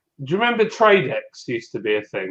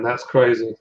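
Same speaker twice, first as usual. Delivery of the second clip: ordinary talk, nothing marked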